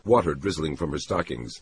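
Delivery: ordinary delivery, nothing marked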